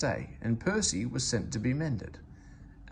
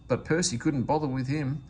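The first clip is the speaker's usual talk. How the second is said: ordinary delivery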